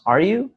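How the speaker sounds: YOU swept up high; rising question intonation. Shown as a demonstration. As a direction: ask it, pitch climbing on YOU. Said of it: "my pitch got higher".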